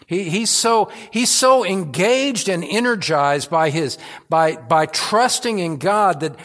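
loud, rising preacher's build; fervent preaching. Acted. Preacher to his congregation, loud and fervent. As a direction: press the point home to the church.

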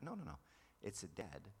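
normal talking voice

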